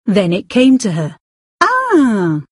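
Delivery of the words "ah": higher sudden "ah" of recognition; sudden realization. Acted. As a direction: catch the memory all at once. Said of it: "she said suddenly".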